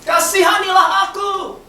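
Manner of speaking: high, long-drawn shout to Jesus; crying out. Acted. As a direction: call out pleading, stretching the names. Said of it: "mulailah ia berseru".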